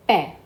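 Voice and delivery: pe with an open "eh"; E with accent. Shown as a demonstration. Teacher reading P E with accent, pe said "peh". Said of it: "with accent".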